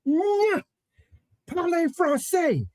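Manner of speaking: high, insistent French lady; older French woman. Retold or imitated. Older woman insisting he speak French, high and insistent. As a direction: correct him sharply like an older French woman. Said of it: "she looks at me and goes".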